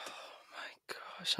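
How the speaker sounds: whispered, slow and breathless with awe; stunned whisper. Real, not acted. Fan stunned by [name]'s verse, slow awed whisper.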